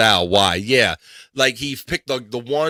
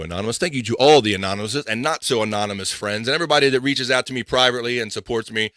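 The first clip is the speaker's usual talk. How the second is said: ordinary delivery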